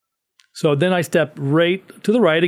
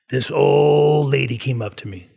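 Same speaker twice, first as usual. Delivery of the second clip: put-on old man's voice. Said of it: "with this loud voice".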